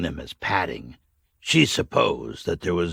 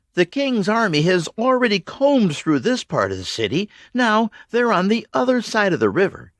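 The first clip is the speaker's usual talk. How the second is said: higher voice, calm explanation; Mags, steady leader; 12 semitones higher, 4 dB louder than usual. A narrator's reading. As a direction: lay out the situation firmly.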